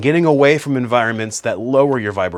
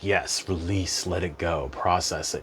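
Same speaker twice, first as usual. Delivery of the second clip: soothing self-talk; 3 semitones lower, 7 dB quieter, slower than usual. Retold or imitated. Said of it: "I'm just like".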